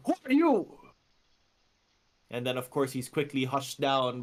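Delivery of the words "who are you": much higher and louder, a barked demand; a burly mob boss calling out from the back; mob boss challenge. Acted. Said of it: "And he says".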